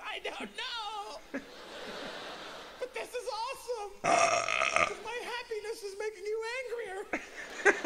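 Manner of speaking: very high, squealing through laughter; acted giggling fit while answering; slow, cracked-up falsetto wail; helpless laughing. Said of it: "I dare you to laugh".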